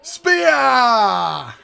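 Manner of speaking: long, loud call stretched out; loud excited yell. Real, not acted. Fan cheering Bobby on shouts the move at the screen. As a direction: belt it out like a ringside fan.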